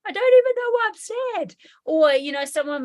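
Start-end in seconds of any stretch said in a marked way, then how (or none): 0.1-1.5 high-pitched, exasperated confusion; acted-out wail of not understanding, pitched way up; bewildered upset. Speaker voices someone baffled by their own sadness, high bewildered wail. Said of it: "you're like".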